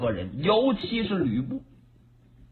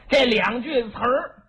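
ordinary delivery

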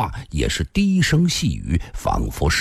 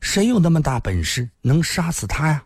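put-on astonished girl, rising into a higher pitch; surprised; 5 semitones higher than usual. A narrator's reading. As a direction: ask in sheer disbelief. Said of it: "辫子姑娘惊讶地说道". (惊讶地追问)

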